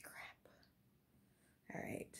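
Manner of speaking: hushed muttered crap; under the breath, caught out; whispered dismay. Retold or imitated. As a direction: whisper to herself, resigned. Quiet reaction as child cries.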